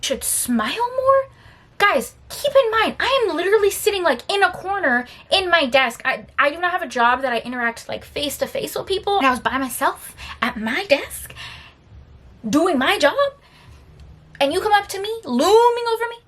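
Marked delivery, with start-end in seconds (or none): 0.0-1.3 high, slow disbelieving question; incredulous repeat. Real, not acted. Incredulous repeat of his remark. 1.8-6.0 high-pitched exasperated appeal; incredulous, high protest; indignant. Real, not acted. Indignant plea to viewers. 12.5-16.2 high, rising incredulous question; high-pitched affronted questioning; indignant disbelief. Real, not acted. Indignant at the coworker.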